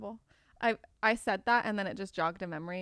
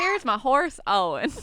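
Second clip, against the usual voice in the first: put-on Southern accent; 4 semitones higher, 7 dB louder than usual. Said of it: "How would you say it in a Southern accent?".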